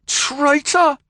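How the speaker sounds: very high, loud accusing yell; Billy Joe yells at the possessed man; reckless yell. Acted. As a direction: hurl the word at him. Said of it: "Billy Joe yelled recklessly".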